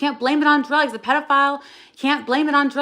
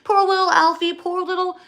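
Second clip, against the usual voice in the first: high cooing mock sympathy, much higher; imitating the family's doting, pitying tone; 7 semitones higher than usual. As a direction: coo over him like a babied child. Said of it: "his family would just be like".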